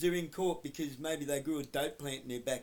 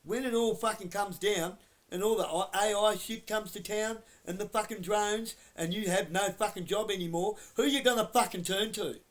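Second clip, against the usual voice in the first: real anger, louder and higher throughout; heated prophecy to officers who will be jobless; loud warning rant; 5 semitones higher, 5 dB louder than usual. Real, not acted. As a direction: build the threat and drive it home. Warning police about future.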